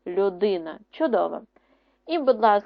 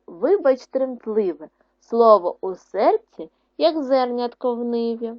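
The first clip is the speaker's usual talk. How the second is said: louder, expressive poem reading; poem recital; 5 dB louder than usual. Acted. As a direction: recite the verse to the children with feeling. Teacher reads poem aloud. (виразне читання вірша)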